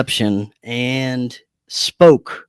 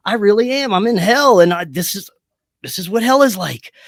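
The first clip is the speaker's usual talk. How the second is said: raised, higher-pitched dawning horror; retold alarm; 6 semitones higher than usual. Man convincing himself he is in hell, alarmed. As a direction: replay the panicked realization, voice climbing. Retold realization of being dead.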